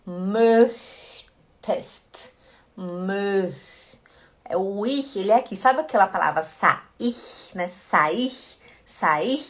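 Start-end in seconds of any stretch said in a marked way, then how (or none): 0.1-4.0 "Möchtest" with "Möch" held long on ö and ch; drawn-out ch demo. Shown as a demonstration. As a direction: stretch the hissed ch in "Möch". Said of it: "olha a garganta".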